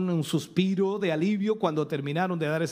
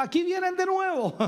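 mock lookout shout, pitched up high; watchman's cry; 12 semitones higher than usual. Preacher voicing the watchman spotting Israel again, mock cry. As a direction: sing out the warning from the wall. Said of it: "El atalaya en el muro gritaba".

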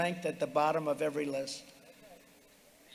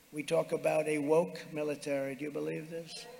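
ordinary talk

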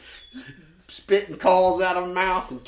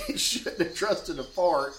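ordinary talk

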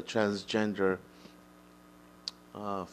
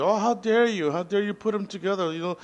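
much higher, louder mock indignation; mock the offended voices; outraged critic; 10 semitones higher, 4 dB louder than usual. Imitating people who disagree with him, high and indignant. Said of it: "disagree with me and say".

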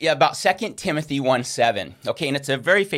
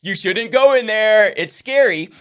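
raised, loud, drawn-out warning; barking dog of fear; 6 semitones higher, 7 dB louder than usual. Acted. Dogs at destiny's doorway scaring someone off, loud mock warning. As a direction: warn them off like a dog of fear. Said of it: "dogs there barking of fear to be like".

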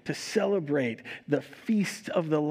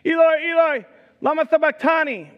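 high, full-voiced anguished cry; crying out loudly; 11 semitones higher, 10 dB louder than usual. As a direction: cry it out to heaven at full volume. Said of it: "cried out in a loud voice".

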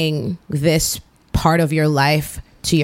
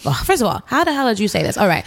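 ordinary delivery

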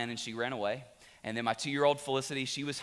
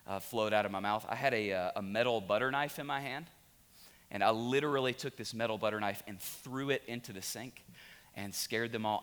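ordinary delivery

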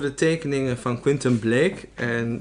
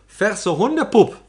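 higher, louder, drawn-out emphasis; emphatic correction; 9 semitones higher, 7 dB louder, slower than usual. Acted. Co-host correcting the other about what children smell like, emphatic. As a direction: relish each word, loud and slow.

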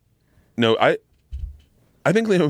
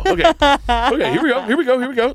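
mock psyching up; 11 semitones higher than usual. Psyching himself up, retold.

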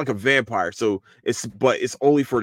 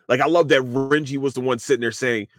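ordinary delivery

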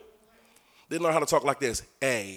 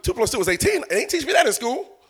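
high, squeaky "wait, what?" then a laughing puzzle; shocked disbelief; 6 semitones higher, 4 dB quieter than usual. Acted. Believer reacting to God, high and stunned. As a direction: act floored by the news.

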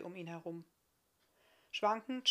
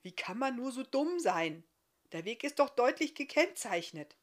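raised, higher-pitched exasperation; reproachful scolding; 5 semitones higher, 6 dB louder than usual. A narrator's reading. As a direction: scold him for his stupidity. Stranger scolds the stuck giant.

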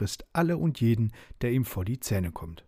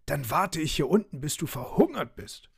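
higher-pitched thwarted fury; raging, barking; 6 semitones higher than usual. A narrator's reading. Wild dog stuck below the tree, raging in a higher voice. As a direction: rage up at the one who escaped. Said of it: "er knurrte und tobte und bellte".

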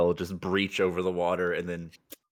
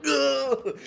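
high-pitched "ugh" of distress; startled grunt. Acted. Guide struggles with spurting orb, startled high grunt. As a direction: yelp as if hit by spray. Guide hit by spraying water.

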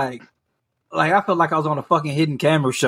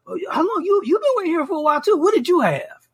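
much higher, fast, prying chatter; nosy busybody; 12 semitones higher, faster than usual. Retold or imitated. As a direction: rattle off her nosy questions quickly. Mimicking the same nosy customer.

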